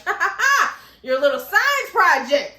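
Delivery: loud, high fake laugh into a drawn-out taunt; mocking villains. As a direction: jeer with a hammed-up ha-ha-ha. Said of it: "They were just like".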